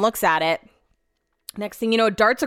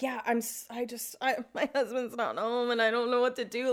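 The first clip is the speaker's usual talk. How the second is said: soft, halting, flustered excuse; quietly helpless, imitating a flustered self; meek stammer; 11 dB quieter than usual. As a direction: stammer it out apologetically, well under your breath.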